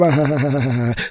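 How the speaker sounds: loud cackle of a friend; mocking cackle. Friends laughing at him, loud cackle.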